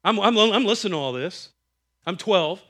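ordinary talk, nothing marked

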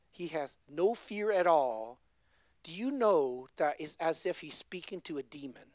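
ordinary talk

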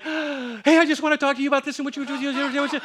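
high, rushed, chirpy wife voice; a lightly voiced wife, high and quick; mimicking wife. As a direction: rattle it off like an eager wife. Wife's eager chatter in the stand.

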